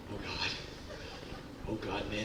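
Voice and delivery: soft, trembling plea under the breath; whispered oh God on a scary flight; hushed frightened prayer. Retold or imitated. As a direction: pray quietly in terror. Terrified praying on the plane.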